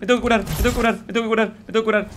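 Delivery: high, rapid-fire panicked repetition; frantic panic. Real, not acted. Player desperate to heal in the game, frantic panic. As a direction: gabble it urgently at a high pitch.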